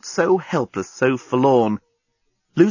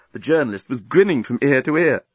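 usual speaking voice

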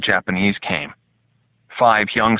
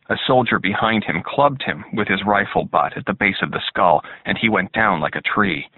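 plain reading voice

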